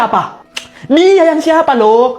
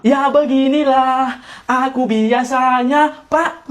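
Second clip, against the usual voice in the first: slow, drawn-out phrases; exaggeratedly measured speech; overdone delivery; 4 dB quieter, slower than usual. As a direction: stretch the words out in an affected way. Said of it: "Gak usah lebay gitulah".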